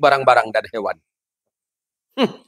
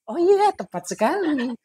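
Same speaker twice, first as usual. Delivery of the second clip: coy wife on phone; 8 semitones higher than usual. Acted.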